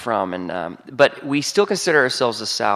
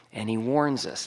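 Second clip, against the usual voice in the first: ordinary talk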